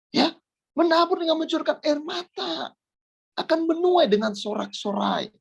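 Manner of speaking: high, ringing exhortation; impassioned preaching. Real, not acted. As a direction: preach it high, urging the congregation on. Preaches a promise with raised voice. (khotbah berapi-api bernada tinggi)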